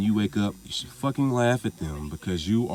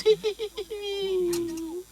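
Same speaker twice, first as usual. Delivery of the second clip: very high, thin mocking giggle; imitated demon giggle; slower than usual. Retelling how the demon laughed at him, high eerie giggle. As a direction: cackle it like a creepy little demon. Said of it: "and he starts to laugh".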